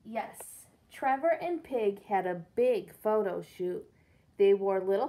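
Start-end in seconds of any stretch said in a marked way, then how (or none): none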